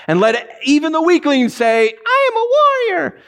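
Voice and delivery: high, mocking squeak for the weakling's boast; mock the puny boast way up high. Preacher mocking the weak nations' boast, high and sarcastic. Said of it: "he turns it on his head".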